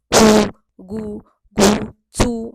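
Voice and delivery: खू and चू loud, very slow and drawn out; long oo. Shown as a demonstration. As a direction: lengthen each u sound. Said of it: "मोठा उच्चार, दीर्घ उच्चार होतो". (दीर्घ ऊ लांबवून)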